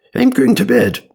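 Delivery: comic high character voice; high-pitched retake. Acted. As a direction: pipe it up in a silly high voice. Tries line again, much higher.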